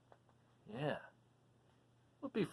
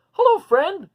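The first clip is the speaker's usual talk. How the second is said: high, loud friendly hello; eager dragon greeting; 12 semitones higher, 14 dB louder than usual. Acted. Duncan greets the raccoon in a high, loud, eager voice. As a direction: call out a big happy hello.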